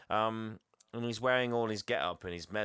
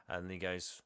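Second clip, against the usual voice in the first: usual speaking voice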